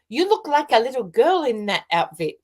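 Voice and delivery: a hint of an Indian man's accent, voice lifted up. Fan complimenting her outfit after the show, light Indian accent. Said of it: "with his little accent".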